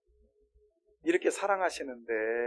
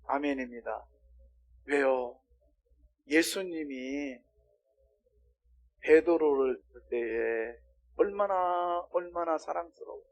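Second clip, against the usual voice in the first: normal talking voice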